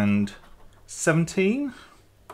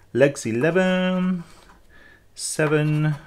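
bingo caller; 4 semitones higher, 4 dB quieter, slower than usual. Acted. Bingo call while scratching numbers.

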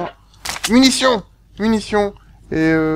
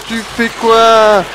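very slow, stretched "Tu fais quoi?"; dragged-out question; 5 semitones higher, much slower than usual. Real, not acted. Gamer baffled by a useless companion, stretched-out question. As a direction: draw out every syllable of "Tu fais quoi?".

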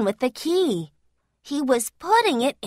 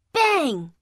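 ordinary delivery

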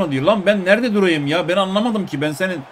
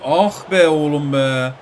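slow, loud groan of despair; slow groaning lament; exasperated groan; 6 dB louder, much slower than usual. Real, not acted. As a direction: drag it out in dismay. Gamer groaning at a missed chance.

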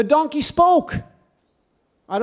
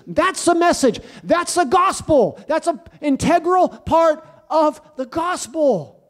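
raised, driving sermon voice; preaching at full force; 4 semitones higher than usual. Real, not acted. Preacher warning his congregation, at full force. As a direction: hammer home the warning.

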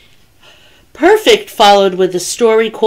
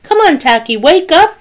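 high pleading call, climbing; urgent wake-up call; 10 semitones higher, 3 dB louder than usual. A narrator's reading. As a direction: urge the sleeper up, pitch rising. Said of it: "they repeated".

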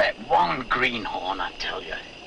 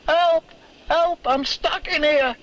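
voice calling for help; 11 semitones higher than usual. Retold or imitated. Miner retelling the ventriloquist's trapped voice, crying help. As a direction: shout for help from inside the coal. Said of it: "And the voice said".

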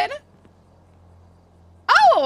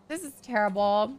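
ordinary talk

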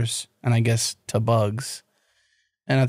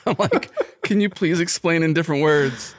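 laughing; 5 semitones higher than usual. Real, not acted.

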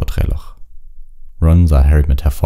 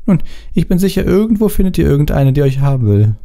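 put-on high-pitched, pert girl voice; snippy know-it-all girl; 14 semitones higher than usual. A narrator's reading. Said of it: "sagte Hermine schnippisch".

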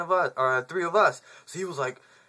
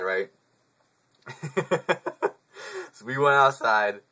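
normal talking voice

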